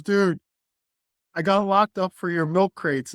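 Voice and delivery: upset call. Retold or imitated.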